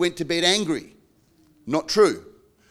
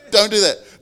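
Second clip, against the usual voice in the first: ordinary talk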